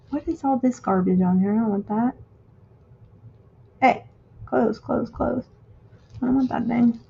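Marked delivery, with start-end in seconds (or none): none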